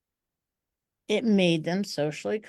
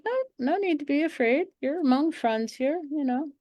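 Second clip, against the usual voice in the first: much higher, sugary mock-soothing voice; mock reassurance; 8 semitones higher than usual. Speaker calming the standing audience, in a high mock-sweet voice. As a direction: coo at them to fake calm, pitched way up. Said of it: "I was like".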